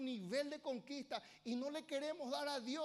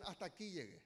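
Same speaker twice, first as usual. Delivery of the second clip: mock resignation, softer; defeated quit; 5 semitones lower, 5 dB quieter than usual. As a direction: say it like someone giving up. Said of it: "muchos dicen".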